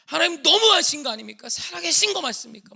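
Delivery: high, very loud challenge to God; re-enacted screaming protest; accusing shout. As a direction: confront God at full voice. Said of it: "막 이러면서 따졌어요".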